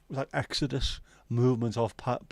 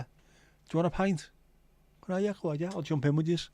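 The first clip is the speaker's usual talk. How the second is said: offering a pint; 7 semitones higher than usual. Retold or imitated. Stranger offering a pint to a lad, high friendly voice. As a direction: sound keen and matey, pitched up. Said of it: "Where you going?".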